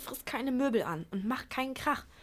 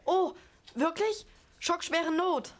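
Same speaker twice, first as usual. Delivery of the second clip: overdone surprise; 4 semitones higher, slower than usual. Pretend. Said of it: "Eure übertriebene Reaktion hat euch verraten".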